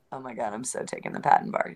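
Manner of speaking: low, soft quoted thought; hushed inner thought. Retold or imitated. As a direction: drop down as if telling yourself. Acted quote of own thought.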